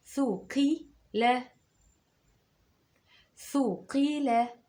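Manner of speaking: "ثقيلة" very slow; careful slow "ثقيلة"; teacher warning pupils to listen; slow dictation. Shown as a demonstration. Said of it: "خلي بالك".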